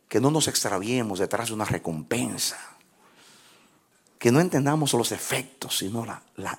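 prayer. Acted. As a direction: pray gently and earnestly. Leads closing prayer.

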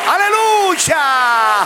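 very high, loud, drawn-out aleluya; preaching shout. Acted. Preacher shouting praise to the congregation, full force. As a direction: call the church to praise.

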